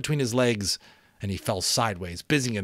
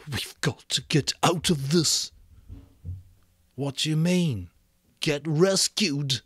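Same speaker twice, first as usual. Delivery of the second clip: higher, lightly voiced boy; a boyish Piggy voice; 7 semitones higher than usual. A narrator's reading. Piggy and Ralph in dark shelter.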